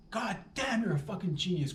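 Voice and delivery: high-pitched, hammed-up astonishment; mock amazement. Grandchild marvels at grandma's hiccup cure, mock amazed. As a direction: exclaim as if floored by genius.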